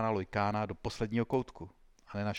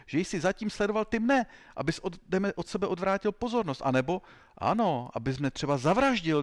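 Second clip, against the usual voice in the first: worked-up, high-pitched interrogator's tirade; roaring accusation; 8 semitones higher than usual. A narrator's reading. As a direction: pile on accusations in a raised voice. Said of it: "zařval agent X tři".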